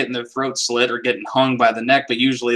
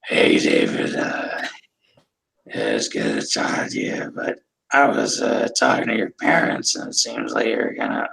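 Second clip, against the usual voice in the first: low, slow, rasping voice box imitation; damaged voice; 5 semitones lower, 3 dB quieter than usual. Imitating the school dean's ruined voice. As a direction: do the dean who was hit in the throat. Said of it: "he would go like this".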